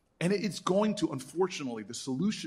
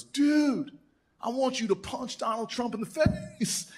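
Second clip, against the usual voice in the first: eager big guy; 9 semitones higher, slower than usual. Retold or imitated. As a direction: pump it up like an eager fan. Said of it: "looks at me and goes".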